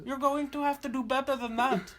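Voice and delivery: high mock-German old man; slight German accent. Old customer ends his phone complaint, high slightly German accent. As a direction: scold in a high, German-tinged put-on voice. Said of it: "turned a little German there at the end".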